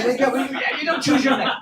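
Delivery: ordinary talk, nothing marked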